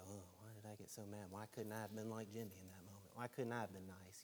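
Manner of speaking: soft, hushed asking of oneself; quiet inner voice of remorse; regretful self-talk. Retold or imitated. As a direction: mutter it to yourself in regret. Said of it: "And then you kinda look and go".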